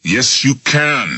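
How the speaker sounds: urging. Acted. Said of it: "Smith urged".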